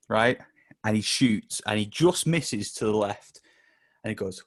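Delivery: ordinary delivery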